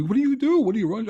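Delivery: hammed-up bewildered executive; mock baffled president. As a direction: squeak out the boss's confusion. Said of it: "He goes".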